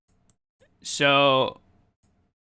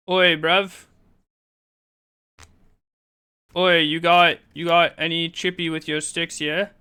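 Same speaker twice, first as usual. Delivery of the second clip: hammed-up London geezer, British accent, louder; a cheeky British bruv accent, a bit louder; mock British accent; 3 semitones higher, 3 dB louder than usual. Streamer greeting chat in a mock British accent. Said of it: "Am I doing the British thing correctly?".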